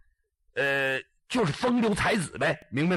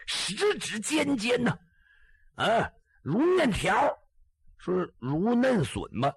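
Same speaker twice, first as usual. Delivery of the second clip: ordinary delivery, nothing marked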